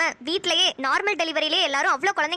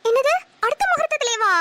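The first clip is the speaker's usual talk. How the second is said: higher, louder mocking repeat of the shocked question; mock echo of shock; 8 semitones higher, 4 dB louder than usual. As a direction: mimic the shock, hammed up. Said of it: "mocking echo of the shocked question". (கிண்டலாக அதிர்ச்சியை நகலெடுத்தல்)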